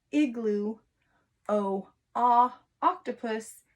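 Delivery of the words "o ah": short o as ah, sounded out; vowel sound demo. Shown as a demonstration. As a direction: model the ah sound for O. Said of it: "let's do a quick review".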